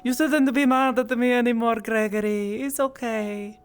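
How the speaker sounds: high-pitched, pleading character voice; Mira. Acted. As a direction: plead high, then soften into reassurance. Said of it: "struggle to do Mira's voice".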